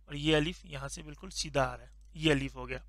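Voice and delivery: ordinary talk, nothing marked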